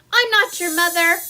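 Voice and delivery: loud, stern denial; stern shout. Acted. Grumpy bear scolding the following goslings, stern loud. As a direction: snap it at them, fed up. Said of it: "Bruce was very stern".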